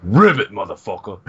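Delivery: loud croaked frog impression. Co-host voices the scary frog, croaking frog voice.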